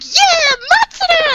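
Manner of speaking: high, loud cheering shout; excited cheer. Retold or imitated. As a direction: cheer him on wildly from below. Misa cheers him on.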